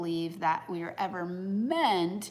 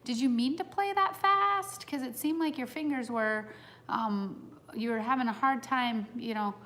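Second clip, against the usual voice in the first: high, sweet, falsely innocent question; much higher, innocent-sounding mom voice; feigned innocence; 6 semitones higher than usual. Retold or imitated. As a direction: ask it as if it's nothing at all. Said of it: "seeming very innocent".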